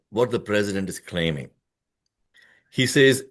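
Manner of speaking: normal talking voice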